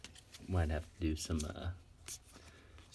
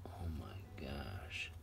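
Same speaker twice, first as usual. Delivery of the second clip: slow hushed whisper of awe; awed whisper; 6 semitones lower, 12 dB quieter, much slower than usual. Real, not acted. Collector to himself over a card, awed whisper. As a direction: breathe it out in wonder.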